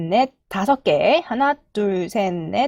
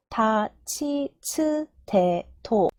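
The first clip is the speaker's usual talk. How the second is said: very slow, evenly spaced kana drill; chant-like slow row recital; syllable-by-syllable recitation; much slower than usual. Shown as a demonstration. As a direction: model each syllable for learners, held and separated. Teacher models the ta row.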